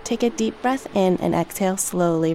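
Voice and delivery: usual speaking voice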